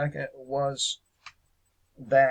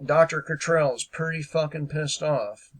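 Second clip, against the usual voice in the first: usual speaking voice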